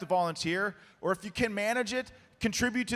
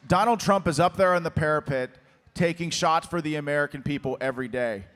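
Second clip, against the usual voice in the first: ordinary talk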